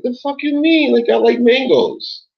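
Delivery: high, indignant mock protest; retelling a defensive reply to the woman; indignant retort.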